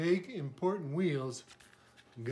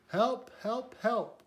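high storybook cry for help; 6 semitones higher, 4 dB louder, slower than usual. A narrator's reading. Little truck stuck in mud calling friends, high cry. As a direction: call out higher, in short repeated pleas. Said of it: "cried the little blue truck".

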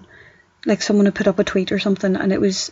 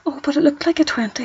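confused customer; 5 semitones higher, 3 dB quieter than usual. Retold or imitated. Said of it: "people get confused because".

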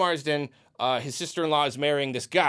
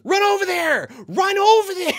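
much higher and louder, cracking into a laugh; yelling at screen; 13 semitones higher, 7 dB louder than usual. Retold or imitated. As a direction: scream at the hero to run, half laughing. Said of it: "I'm just yelling at the screen".

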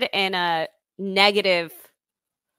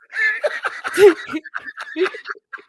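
airy unvoiced laugh, higher; laughing off the accusation, airy laugh; breathy laugh; 7 semitones higher than usual. Real, not acted. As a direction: let out a quick breathy laugh. Laugh during argument.

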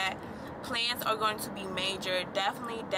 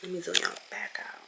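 hushed stressed whisper; 3 semitones lower, 5 dB quieter than usual. Real, not acted. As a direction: keep it down, barely holding it together. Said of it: "I'm trying not to lose my cool".

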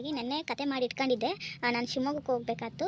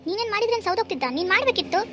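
higher, louder shout; angry shout; 7 semitones higher, 6 dB louder than usual. Acted. Wife shouting back at her husband in a quarrel, angry. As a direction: fling it back at him.

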